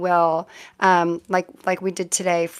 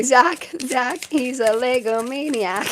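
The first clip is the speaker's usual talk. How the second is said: put-on old commercial jingle; 3 semitones higher, slower than usual. Reader greets viewer Zach with an eighties ad line, mock jingle. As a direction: sing it out like a cheesy old commercial. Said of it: "Yes, Lego maniac, exactly".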